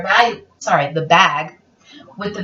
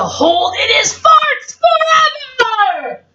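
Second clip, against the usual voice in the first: high, slow, wailing cry; dramatic shout; 12 semitones higher, 4 dB louder, much slower than usual. A narrator's reading. Reading to a child, a mock-tragic wailing vow. Dramatic climax of dog story.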